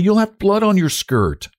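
high, scolding caution; sharp warning. A narrator's reading. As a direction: warn her off sharply. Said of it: "said Frances sharply".

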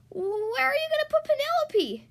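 put-on high, loud anxious girl; little girl voice. A narrator's reading. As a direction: ask it as a worried little girl. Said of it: "asked Miranda".

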